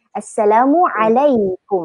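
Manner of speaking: slow Assalamualaikum with a carefully pressed throat ain; beautiful ain. Shown as a demonstration. Teacher showing learners a beautiful ain, Assalamualaikum slow and careful. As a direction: Assalamualaikum drawn out, ain clearly sounded before "alai". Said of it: "ain tu kena cantik".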